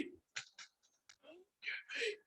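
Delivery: slow, barely breathed whisper; imitating dad's whispered plea; dying whisper. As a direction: plead in a whisper from a hospital bed. Dying dad pleading on phone.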